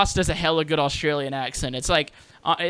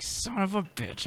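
slow, higher, softer mock curse; mock pissed off; 4 semitones higher, 8 dB quieter, slower than usual. Podcaster retelling losing a guest to a rival, mock annoyed. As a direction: grumble it in playful frustration. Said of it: "your podcast pisses me off".

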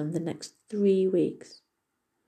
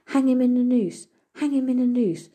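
higher, louder call, hammered twice; 6 semitones higher, 7 dB louder than usual. Retold or imitated. Robber retelling the judge on the roof, loud mock call. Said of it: "sitting, calling out".

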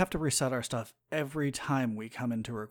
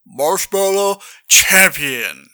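slow, much louder cheering shout; 4 semitones higher, 15 dB louder, slower than usual. Acted. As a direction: hype up your friend. Cheering friend's marshmallow stunt.

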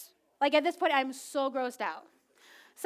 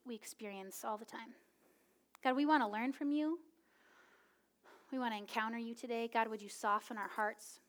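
prayer; 2 semitones lower, 6 dB quieter than usual. Acted. Pastor opening the service in quiet prayer. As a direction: pray quietly over the congregation.

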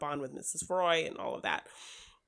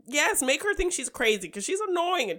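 mock exasperation; 6 semitones higher, 4 dB louder than usual. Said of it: "And I'm like".

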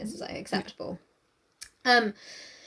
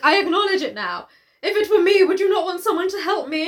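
raised, higher-pitched appeal; acted pleading; 6 semitones higher, 4 dB louder than usual. Wife appealing to Darcy for help, high insistent pleading. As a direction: plead the case, climbing higher and louder. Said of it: "she's like".

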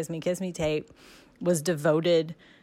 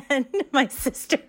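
higher, louder, laughing through the words; laughing while talking; 7 semitones higher, 5 dB louder than usual. Real, not acted. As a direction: giggle through it, amused at herself. Laughing at her own tears.

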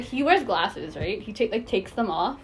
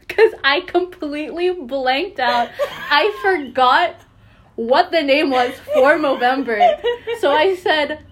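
laughing, louder and higher; 4 semitones higher, 6 dB louder than usual. Real, not acted. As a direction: crack up retelling it. Laughing at own blunder.

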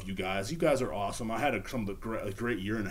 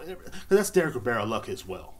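ordinary talk, nothing marked

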